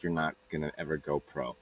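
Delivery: low, quick, softened put-down; dismissive put-down. Retold or imitated. Stepsister tells him he won't go pro, dismissive.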